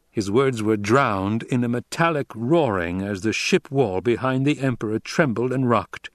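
ordinary delivery

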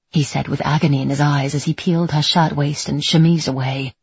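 usual speaking voice

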